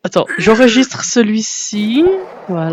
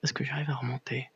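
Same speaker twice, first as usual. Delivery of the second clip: whispering; 6 semitones lower, 22 dB quieter than usual. Pretend. Sneaking as an assassin.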